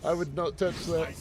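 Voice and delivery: ordinary talk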